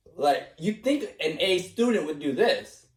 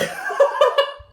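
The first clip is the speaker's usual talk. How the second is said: airy laugh. Real, not acted. Laughing at own slacking.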